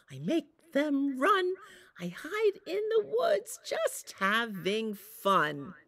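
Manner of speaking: put-on a playful mother scaring the boys; Mama Wolf. Mama Wolf's teasing chant.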